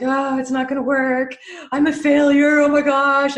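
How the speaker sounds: mock self-doubting panic; panicked inner voice. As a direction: blurt it like everything is falling apart. Collapsing into fear and doubt.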